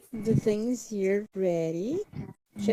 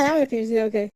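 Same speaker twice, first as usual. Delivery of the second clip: ordinary delivery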